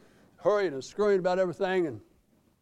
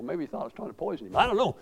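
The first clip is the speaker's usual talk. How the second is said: ordinary delivery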